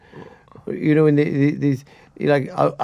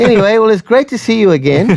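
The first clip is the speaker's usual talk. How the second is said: cheery goodbye; 8 semitones higher, 4 dB louder than usual. Retold or imitated. Awkward cheery goodbye after bad news.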